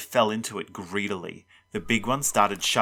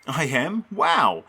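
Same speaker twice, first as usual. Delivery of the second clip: higher, amazed reaction; surprised; 6 semitones higher, 4 dB louder, slower than usual. A narrator's reading. Player hearing he is starting catcher, surprised. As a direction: react with pleased disbelief.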